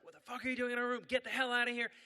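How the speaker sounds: high-pitched, irate woman; acted-out furious outburst; not amused. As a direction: order the intruder out, high and angry. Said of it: "the other woman was not amused".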